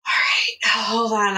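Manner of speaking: ordinary delivery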